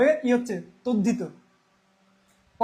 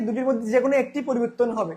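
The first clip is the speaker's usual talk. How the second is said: ordinary talk, nothing marked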